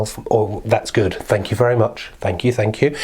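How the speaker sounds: ordinary delivery, nothing marked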